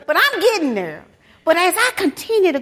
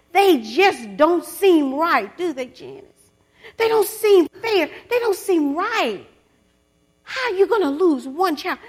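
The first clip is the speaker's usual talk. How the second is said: louder and pleading, rising in anguish; impassioned lament; 4 semitones higher, 4 dB louder than usual. Real, not acted. Preacher lamenting loss to the congregation, pleading and loud. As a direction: cry out the unfairness.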